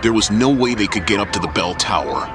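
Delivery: normal talking voice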